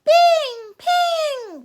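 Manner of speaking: very high, loud ringing bing and ping; magic pistol shots, high and loud; magic gunshot ring. Acted. Magic pistol shot sounds.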